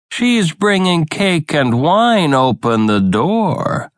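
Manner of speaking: a wolf pretending to be a child; wolf faking the girl. A narrator's reading.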